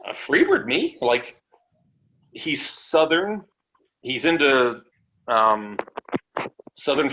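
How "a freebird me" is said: high disbelieving squeak; hearing the team offer, high and incredulous. Retold or imitated. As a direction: sound stunned at the offer. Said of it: "I was like".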